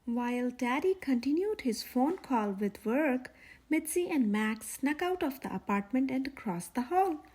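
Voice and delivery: usual speaking voice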